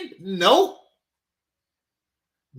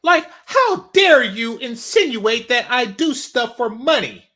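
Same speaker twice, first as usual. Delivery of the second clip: mocking outraged woman; 5 semitones higher, 8 dB louder, slower than usual. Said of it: "mocking the woman".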